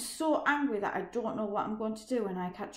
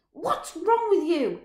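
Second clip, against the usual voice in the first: high, loud and slow; furious shout while pinning him down; angry shout; 9 semitones higher, 11 dB louder, slower than usual. Acted. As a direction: bellow it down at him. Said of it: "he shouts".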